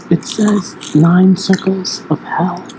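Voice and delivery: slow whisper. Acted. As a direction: whisper it slowly. ASMR segment reading.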